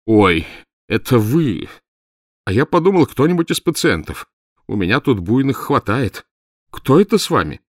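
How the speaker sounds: high, loud cry of relief; naked doctor relieved it's the detective. A narrator's reading. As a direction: blurt it out, rescued. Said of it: "закричал с явным облегчением".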